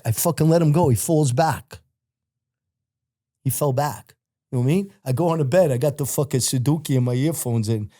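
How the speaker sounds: ordinary talk, nothing marked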